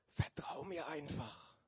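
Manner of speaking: whispered snake voice. Acted. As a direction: breathe it like a hiss. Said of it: "flüsterte die Schlange".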